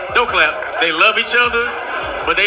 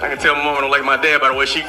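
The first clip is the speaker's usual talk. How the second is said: usual speaking voice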